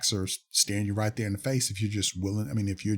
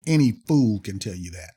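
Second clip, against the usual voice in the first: louder, heated jab at the claim; emotional outburst; 6 dB louder than usual. Real, not acted. Doctor losing patience with a false claim, heated louder jab. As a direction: let frustration spill out, louder. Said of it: "I'm getting a little emotional".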